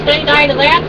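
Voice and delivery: ordinary talk, nothing marked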